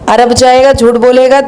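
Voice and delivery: ordinary talk, nothing marked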